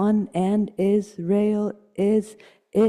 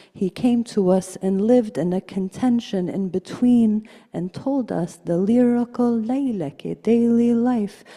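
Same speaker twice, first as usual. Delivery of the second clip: ordinary talk, nothing marked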